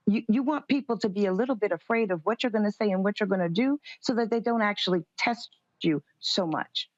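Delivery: ordinary talk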